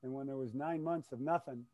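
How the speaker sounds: usual speaking voice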